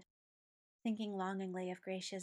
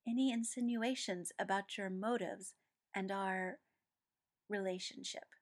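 ordinary delivery

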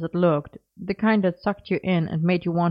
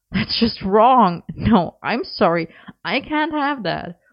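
louder, slow, hammed-up outrage; clutching his chest in pretend pain; mock wounded; 5 semitones higher, 4 dB louder, slower than usual. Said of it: "as if he'd been wounded".

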